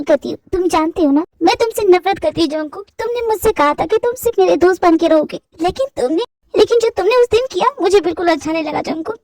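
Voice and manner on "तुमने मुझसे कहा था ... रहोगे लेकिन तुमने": normal talking voice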